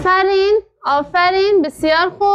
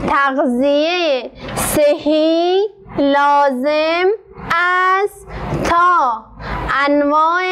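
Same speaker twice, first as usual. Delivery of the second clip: very slow, word by word with long pauses; teacher dictating slowly, word by word; slow dictation reading; much slower than usual. Shown as a demonstration. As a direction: dictate each word slowly for the pupils to write.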